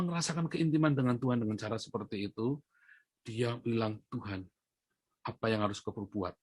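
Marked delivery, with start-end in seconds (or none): 4.1-6.3 softly voiced, low-key plea; quiet prayer. Woman alone in her shop talking to God, quiet prayer. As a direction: pray it under your breath, lightly acted.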